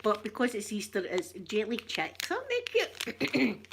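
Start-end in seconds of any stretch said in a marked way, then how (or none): 2.3-3.7 cutesy. Real, not acted. Shows jelly chicks.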